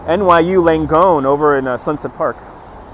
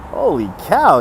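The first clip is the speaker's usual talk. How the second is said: ordinary talk, nothing marked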